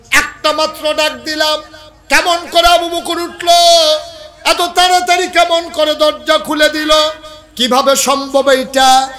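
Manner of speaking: loud, high-pitched cry of amazement; ringing preacher's shout; full-force preaching. Acted. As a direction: belt out each astonished question.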